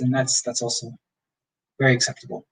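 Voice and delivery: normal talking voice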